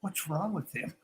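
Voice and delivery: hushed, worried aside; mock-frightened aside. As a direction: imitate an alarmed man asking under his breath. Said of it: "like, this frightened".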